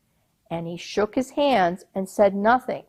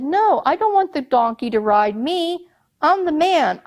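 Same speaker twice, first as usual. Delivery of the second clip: higher, louder protest; Goha protesting; 7 semitones higher, 3 dB louder than usual. Acted. Old man protesting to the captain, indignant. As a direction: object in a rush of indignation.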